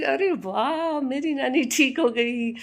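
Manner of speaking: put-on high, slow delighted child voice; a little girl cheering for her grandmother; child's delight. Grandmother imitating granddaughter cheering her recovery, high and slow.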